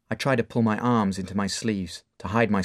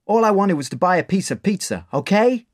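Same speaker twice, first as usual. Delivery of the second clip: higher, louder defensive outburst; angry boy; 10 semitones higher, 6 dB louder than usual. Acted. Dave erupting in anger at Mark: loud defiance. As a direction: snap back at him.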